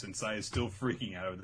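ordinary talk

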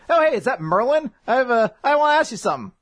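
high, quick, bright greeting; mock sweet princess voice; eager [name]. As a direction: call out to an old friend, lifted high.

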